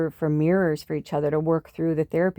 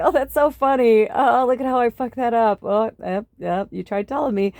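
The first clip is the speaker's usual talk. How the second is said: high, lilting amusement easing down to usual; amused self-mockery; 4 semitones higher than usual. Vlogger showing how mistakes now amuse them, amused self-mockery. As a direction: act out laughing off your own mistake. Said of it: "Now I start laughing".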